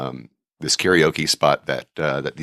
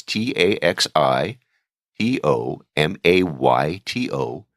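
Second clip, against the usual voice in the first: slow spelling out; slower than usual. Shown as a demonstration. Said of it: "easy to remember".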